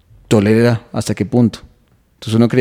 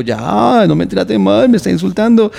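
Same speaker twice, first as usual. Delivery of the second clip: higher, pleading whine; whining boyfriend; 10 semitones higher, 4 dB louder than usual. Acted. Boyfriend begging his taunting girlfriend, higher pleading whine. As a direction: beg her to stop, voice pitched up. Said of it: "Y el tipo".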